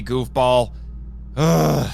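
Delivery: ordinary delivery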